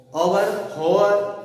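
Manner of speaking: ओवर, होवर said slowly as the mistaken forms; wrong readings. Shown as a demonstration. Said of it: "ऐसा कुछ नहीं होगा".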